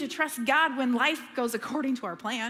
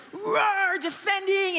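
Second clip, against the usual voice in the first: mock roar; 6 semitones higher, 4 dB louder, slower than usual. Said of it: "They weren't, like".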